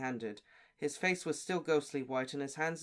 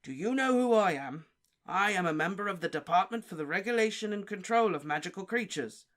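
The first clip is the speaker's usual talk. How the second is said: louder, higher, stern official voice; Diggory questioning the elf sternly; 5 semitones higher, 5 dB louder than usual. Acted. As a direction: lay down your authority. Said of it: "said Mister Diggory sternly".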